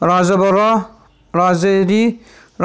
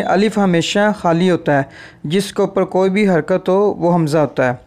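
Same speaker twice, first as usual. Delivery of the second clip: ordinary delivery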